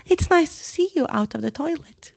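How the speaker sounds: high fake-cheerful chirp; overly sweet politeness; forced brightness. Hermione to Myrtle, forced bright chirp. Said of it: "falsely bright voice".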